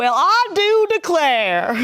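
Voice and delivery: slow, high hammed-up Southern drawl; mock Southern belle. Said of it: "Southern belle".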